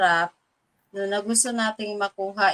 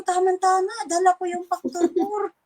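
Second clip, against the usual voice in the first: eager offer; 7 semitones higher than usual. Retold or imitated.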